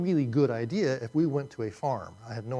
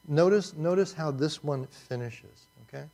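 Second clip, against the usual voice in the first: ordinary talk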